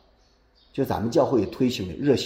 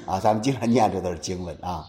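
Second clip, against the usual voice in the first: usual speaking voice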